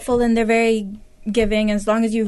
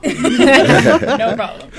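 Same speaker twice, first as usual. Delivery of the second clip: laughing; 4 semitones higher, 9 dB louder, much slower than usual. Real, not acted. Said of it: "thank you".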